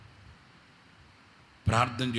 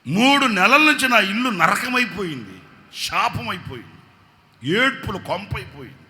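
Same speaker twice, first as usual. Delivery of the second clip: loud, higher lament cried out; fervent preaching; 9 semitones higher, 12 dB louder than usual. Acted. Pastor retelling the wife's woes, preaching loudly. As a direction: preach the family's misery at full force.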